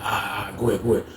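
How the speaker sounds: low, soft and hurried assent; quiet, low-pitched go-ahead; hushed reply. Acted. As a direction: murmur it so nobody overhears. Answers roommate's hushed warning.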